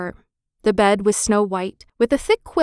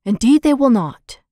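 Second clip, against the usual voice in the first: firm, louder declaration; 3 semitones higher, 5 dB louder than usual. Acted. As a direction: declare it resolutely. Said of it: "declared Henry".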